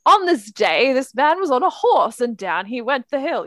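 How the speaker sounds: mock-bardic singsong opening; performative Chaucerian recitation. Shown as a demonstration. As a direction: declaim it like medieval verse read aloud. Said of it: "you're gonna start by going".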